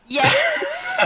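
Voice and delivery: high, loud burst with a laugh; a gleeful cheer; laughing yes; excited yes. Acted. Aunt's eager agreement climax.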